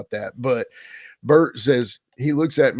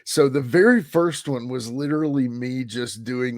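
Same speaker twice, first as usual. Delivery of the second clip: ordinary delivery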